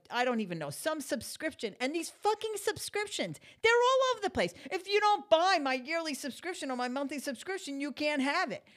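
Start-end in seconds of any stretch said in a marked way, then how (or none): 1.8-8.6 high, loud exasperated rant; angry rant. Real, not acted. To her viewers, ranting furiously about subscriptions. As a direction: vent the fury at every subscription.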